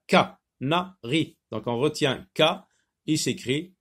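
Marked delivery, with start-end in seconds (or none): 0.1-1.4 "canari" slowly split ca... na... ri; syllable by syllable. Shown as a demonstration. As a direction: separate "canari" into three syllables with short pauses. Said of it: "dans la première syllabe".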